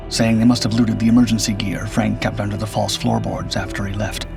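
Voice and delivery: ordinary delivery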